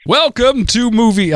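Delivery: mock recorded-announcer welcome; Movie Phone. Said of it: "my movie phone voice".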